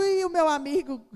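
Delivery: normal talking voice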